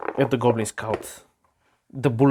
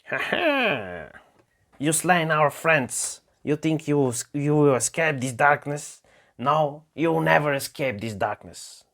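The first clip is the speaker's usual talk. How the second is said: goblin taunt; 4 semitones higher than usual. Acted. Goblin leader mocking the hobbits in the dark, high sneer. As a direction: gloat at the trapped heroes like a nasty goblin.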